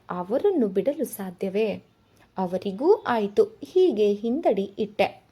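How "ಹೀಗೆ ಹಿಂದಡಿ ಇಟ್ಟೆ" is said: usual speaking voice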